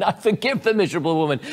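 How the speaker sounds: grudging, fast, forced forgiveness; through gritted teeth. Pretend. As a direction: spit it out like you barely mean it. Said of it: "forgive through gritted teeth".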